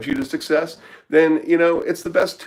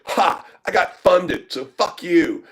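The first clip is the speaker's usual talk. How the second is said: imitating a smug client, higher and taunting; a gleeful higher-pitched jab, half mocking him; mock gloating; 8 semitones higher, slower than usual. Retelling a funded client's taunt, mock gloating.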